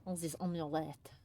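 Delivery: soft, low fancy French-ish "amulet". Pretend. Player teasing her friend, quiet French-ish accent. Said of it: "with a slight accent".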